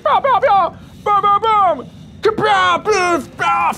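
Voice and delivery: loud high mock gunfire noises; gun sound effects. Gamer imagining shooting people, yelling mock gunfire. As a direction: blast out cartoon shooting sounds. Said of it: "I can imagine shooting some cool people like".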